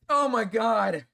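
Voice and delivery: high, loud cry of agony; pained shout. Real, not acted. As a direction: shout it out in real pain. Crying out in pain.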